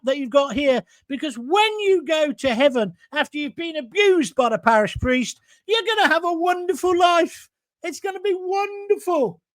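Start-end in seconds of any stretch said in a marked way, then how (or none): 5.7-9.4 mock sweet promise. Real, not acted. Mocking heaven promise.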